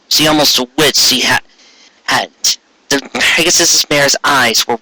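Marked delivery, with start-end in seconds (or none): none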